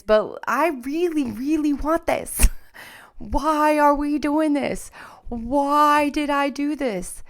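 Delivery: high, slow and pleading; high wail of disbelief. Real, not acted. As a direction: beg up high, half laughing. Pleading, then laughing at herself.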